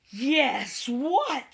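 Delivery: eager high call; excited shout. A narrator's reading. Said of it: "shouted all the animals".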